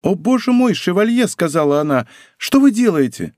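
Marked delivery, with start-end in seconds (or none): 0.0-1.3 high-pitched dismayed exclamation; startled, high protest; alarmed cry. A narrator's reading. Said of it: "несмотря на крик Кэти". 2.4-3.3 high-pitched alarmed question; startled, high outcry; alarmed cry. A narrator's reading. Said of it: "несмотря на крик Кэти".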